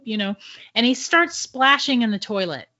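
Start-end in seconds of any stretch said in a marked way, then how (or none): none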